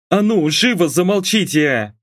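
understated bark to shut them up; barked order. A narrator's reading. Mum silences daughter and husband, mild bark. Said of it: "рявкнула родительница".

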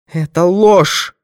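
high, loud, drawn-out denial; woman's high accusing cry; furious denial. A narrator's reading. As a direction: cry out that you reject it, stretching it. Woman denies it in the quarrel.

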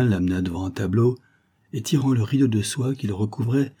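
plain reading voice

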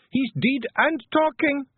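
high, loud Scots cry of alarm; shouting. A narrator's reading. Wife shouting down the hole to the men, alarmed. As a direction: shout the shocking news down a hole. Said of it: "Jeanie shouted down the hole".

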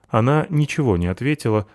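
usual speaking voice

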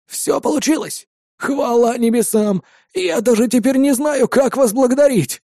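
put-on high, delighted old man; overjoyed with relief; joyful old man. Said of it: "обрадованно ответил старик".

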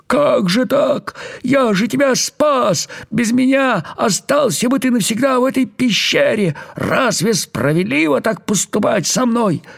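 a hint of crying out for fairness; mild mock shout. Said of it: "закричал изо всех сил". (лёгкий наигранный крик, высоко и медленно)

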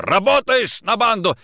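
high, loud demanding question; furious interrogation. Acted. Nikitin strangling Kochkin, furious interrogation. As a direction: shout the question as an accusation. Officer choking man during interrogation.

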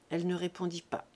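ordinary delivery

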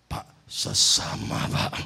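hushed secretive whisper. Retold or imitated. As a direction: whisper it so no one hears. (bisik-bisik rahasia kepada pendeta)

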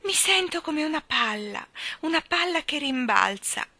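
put-on high, girlish, wondering voice; honey-sweet. A narrator's reading. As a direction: muse giddily in a sweet high voice. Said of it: "dolce come il miele". (voce acuta, dolce come il miele)

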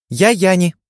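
high squeaky little fairy; tiny fairy voice. Acted. Tiny fairy introduces herself.

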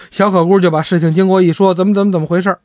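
ordinary delivery

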